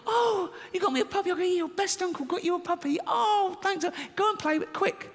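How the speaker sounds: put-on high squeaky child's voice; a thrilled little kid, way up high; gushing kid thanking her uncle; niece voice. Kids' voice, uncle quoting niece.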